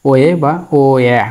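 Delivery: ও এ said slowly, each vowel spelled out clearly; teacher modelling ও এ slowly for the class; slow pronunciation demo. Shown as a demonstration. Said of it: "এটা উচ্চারণ হবে ও এ".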